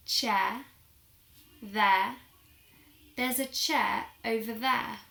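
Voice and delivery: "chair" and "there" ending on open vowels, no R; non-rhotic British "chair", "there"; British silent R. Shown as a demonstration. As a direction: model "chair" and "there" clearly without the final R. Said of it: "the way that I pronounce certain words".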